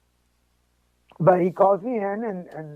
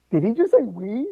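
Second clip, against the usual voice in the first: higher-pitched, astonished question; retold disbelief; 4 semitones higher than usual. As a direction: play back being taken aback, lifting the pitch. Retold disbelief at doctor's word.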